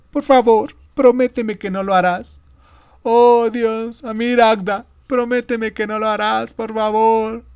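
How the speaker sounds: put-on high, loud wail of a child; panicked pleading. A narrator's reading. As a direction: beg frantically for a promise. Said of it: "pánico que ocultaba". (súplica aguda de niño en pánico)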